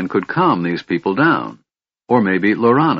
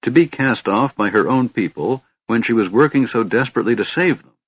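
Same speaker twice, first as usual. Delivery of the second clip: ordinary delivery, nothing marked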